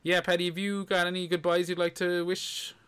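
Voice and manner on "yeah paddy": normal talking voice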